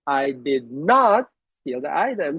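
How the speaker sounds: "not" stressed as the key word. Shown as a demonstration. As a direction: lean hard on "not". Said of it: "stress a word, it creates a different meaning".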